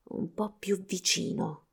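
very soft, slow murmur. A narrator's reading. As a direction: murmur it under your breath while taking aim. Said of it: "mormorò".

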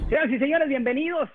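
booming, high show announcer; ringmaster. Acted. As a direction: welcome the crowd like a ringmaster. Host opens the show grandly.

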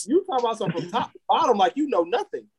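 high, fast teasing jab; rapid, high-pitched mock accusation; teasing call-out. Real, not acted. Teasing co-host over his question.

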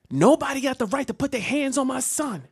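high-pitched indignant mom; defending mother. Retold or imitated. Mom storms in defending her son, high indignant voice. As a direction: declare it high like an outraged mother. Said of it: "my mom came in like".

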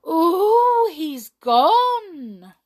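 high, loud astonished cry; amazed. A narrator's reading. Fairies amazed the brownie vanished, surprised cry. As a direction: marvel out loud.

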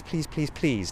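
ordinary delivery

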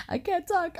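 lightly voiced crying plea; choked up. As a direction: mimic being too choked up to speak. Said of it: "And I was like".

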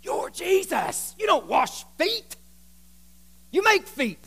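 high, drawn-out disbelief; Peter protesting. Acted. Peter objecting to Jesus washing feet, incredulous. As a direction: protest slowly, pitch leaping up on the key words. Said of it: "I love what Peter says here".

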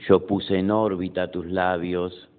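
measured poem reading with pauses after each line; poetry recitation. Acted. Poet reading own poem Noche to an audience, measured recitation.

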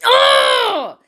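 high, loud drawn-out roar; exultant wordless shout; triumphant yell. Real, not acted. Mother triumphant after the confrontation, exultant yell.

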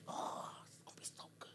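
whispered, scheming glee; gleeful whisper. Retold or imitated. Preacher whispering his gloating over a troll post. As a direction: whisper it like you're plotting to get him.

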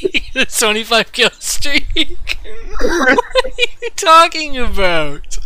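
high, slow, loud laughing; cracking up in disbelief. Real, not acted. Laughing at absurd idea.

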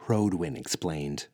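plain reading voice

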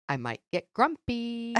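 a lightly voiced preschooler threatening sweetly; hint of a pouty toddler; little girl warning. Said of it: "looked at me with her little beautiful big eyes and says".

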